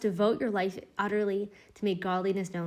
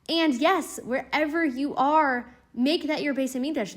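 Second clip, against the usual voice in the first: fervent rise; 6 semitones higher, 6 dB louder than usual. Real, not acted. Rousing climax of the teaching.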